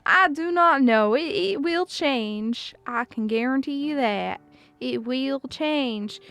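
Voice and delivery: drawn-out southern accent. Shown as a demonstration. Streamer warning the accent will change, in southern accent. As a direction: stretch the words in a loose southern accent. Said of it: "I think we're doing southern right now".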